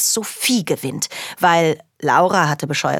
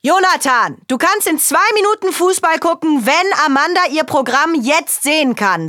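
much higher and louder warning; angry threat; 10 semitones higher, 4 dB louder than usual. Acted. Father threatening Jonathan over the remote, much louder. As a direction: lay down the law.

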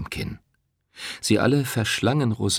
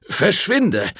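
sharp, high, louder get-out; snapping at her; 10 semitones higher, 4 dB louder than usual. Acted. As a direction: bark her away from the door. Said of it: "fuhr er sie an".

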